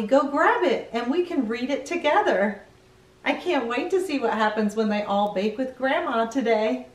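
usual speaking voice

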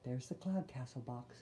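usual speaking voice

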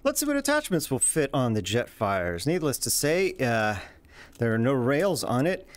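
normal talking voice

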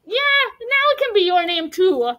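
high-pitched, eager yes; eager agreement. A narrator's reading. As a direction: agree with lifted, animated voice. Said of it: "Francesco agreed".

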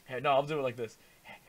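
ordinary talk, nothing marked

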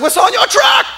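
very high yelled shout; acted-out bridge-crossing yell; imitated scream. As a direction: holler it high from another vehicle. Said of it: "somebody screamed at me".

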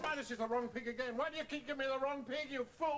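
furious scolding. Acted. Puppeteer blaming helper for the wrong pig, scolding. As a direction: berate them in rising irritation.

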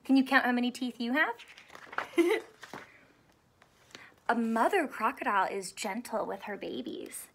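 ordinary talk

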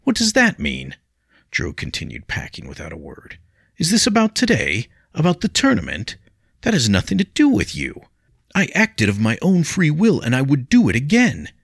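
high, loud, defiant protest; a hot-headed youth standing his ground; agitated young William. A narrator's reading. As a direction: pupil defending himself to his teacher. William argues with Jiro.

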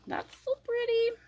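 high, light, delighted coo. Real, not acted. Reacting to a swatch, high delighted coo.

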